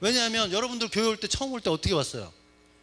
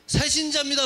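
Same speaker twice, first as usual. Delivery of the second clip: higher and louder, proudly; bold announcement; 7 semitones higher, 5 dB louder than usual. Shown as a demonstration. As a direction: announce it to part the crowd. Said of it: "사람 많을 때 요거 해보십시오". (높고 크게 당당히)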